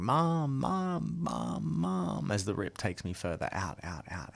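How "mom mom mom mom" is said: high, soft distant cries; hushed high-pitched calls for help; mock screaming. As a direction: act a child's shouts without the volume. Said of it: "I'm screaming and I'm shouting".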